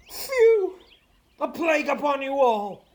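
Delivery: high, loud theatrical ranting; hammy stage actor. Acted. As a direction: bluster like an old ham actor. Falstaff ranting at thieves.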